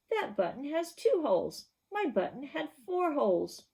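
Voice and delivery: a toad complaining. Acted. Toad explains, higher voice.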